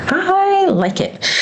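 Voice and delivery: high, slow, pleased I like it; delighted squeal. Real, not acted. Planner vlogger pleased with her layout, high delighted squeal.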